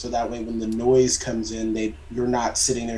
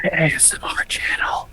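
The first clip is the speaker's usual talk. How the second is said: ASMR whisper; 6 semitones higher than usual. Pretend. Joking about whispering.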